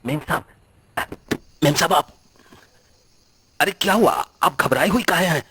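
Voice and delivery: a startled servant rushing in; alarmed caretaker. Acted.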